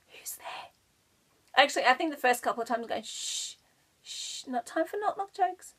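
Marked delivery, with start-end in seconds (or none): 0.1-0.7 breathy whispered reply, barely audible; quiet whisper. Retold or imitated. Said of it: "I whispered very quietly". 3.0-5.7 a shushing mum, hushed and then much higher; hushing mum. Retold or imitated. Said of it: "doing the whole ... kind of mum thing".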